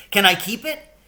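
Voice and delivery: put-on high eager little boy; child's voice. A narrator's reading. Billy begging his mother to keep the mouse, high and eager. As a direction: ask like a hopeful kid.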